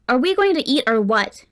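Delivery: irritated snap at the table; snapped. A narrator's reading. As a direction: bite the words off impatiently. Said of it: "Cade snapped".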